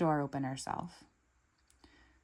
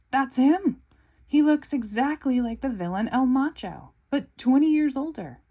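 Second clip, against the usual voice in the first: excited; 8 semitones higher, 9 dB louder than usual. Acted. Gru recognizes the villain.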